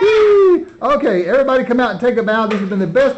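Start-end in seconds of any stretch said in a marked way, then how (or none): 0.0-0.6 gleeful whee. Pretend. Happy ending dance.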